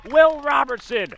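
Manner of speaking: big, loud, soaring home run call. Real, not acted. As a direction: let it rip as the ball leaves the yard. Home run call, rising crowd moment.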